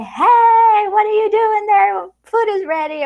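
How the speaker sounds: high, loud call to another room; shouting across rooms. Retold or imitated. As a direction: holler it from room to room. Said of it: "shout at each other like".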